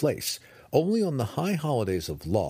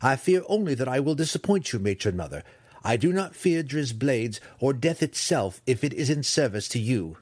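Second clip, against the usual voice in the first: higher, earnest voice of a loyal son; eager, higher-pitched pledge of service; dutiful son; 4 semitones higher than usual. Acted. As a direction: pledge yourself to your mother.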